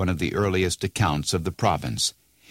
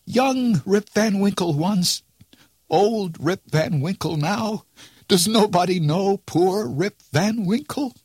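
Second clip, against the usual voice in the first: put-on much higher, emotional old Rip crying out; 12 semitones higher than usual. A narrator's reading. Old Rip to his daughter, crying out much higher. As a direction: burst out with it, overcome. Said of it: "cried he".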